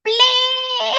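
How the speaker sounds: high drawn-out bleat; mock imitation noise. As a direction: let out a silly high "bleh". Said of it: "you don't sound like that".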